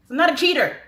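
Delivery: loud, high-pitched yell; triumphant shout. Pretend. Wife to Drake after hanging up on husband, loud gleeful shout. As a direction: belt it out as the punchline.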